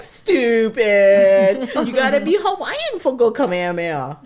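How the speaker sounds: mocking big brother. Big brother scoffing at sister at the dinner table, loud high pidgin tease. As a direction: jeer at the little sister in pidgin, laughing. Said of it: "And he's like".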